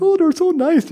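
high, loud mock delight over knickknacks; mock-admiring shelf ornaments; gushing. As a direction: coo over a treasured trinket. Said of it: "that we think".

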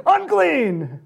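rising to a loud, high cry of Unclean; leper's shout. Retold or imitated. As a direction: shout it out to warn the crowd. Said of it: "you gotta shout".

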